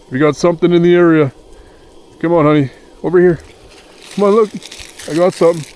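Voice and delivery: loud, high call to the dog; calling the dog Kia for a treat. Real, not acted. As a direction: call her over across the camp.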